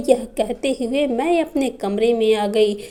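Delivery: ordinary delivery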